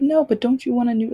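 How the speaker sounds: loud, high, fast pleading voice; hammed-up ex coaxing; imitated ex. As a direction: wheedle like a pushy boyfriend. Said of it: "And he was like".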